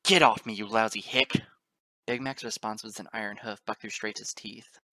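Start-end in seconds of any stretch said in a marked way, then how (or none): 0.0-1.4 very loud, outraged protest; loud sneer. A narrator's reading. Father pinned by a farm stallion, loud angry sneer. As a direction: sneer and yell at the attacker. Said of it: "Straight Edge sneered".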